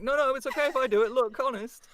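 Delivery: high, loud, rushed protest; hammed-up frantic self-defence; mock pleading. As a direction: plead your case fast and shrieky, in jest. Acting out pleading with listeners.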